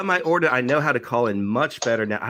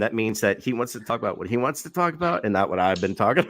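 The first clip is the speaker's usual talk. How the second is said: usual speaking voice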